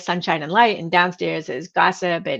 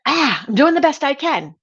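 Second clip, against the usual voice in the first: re-enacted exasperated cry, high and louder; frustrated outburst; 7 semitones higher, 5 dB louder than usual. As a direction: blurt it out in mock exasperation, pitch jumping up. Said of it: "when I am frustrated".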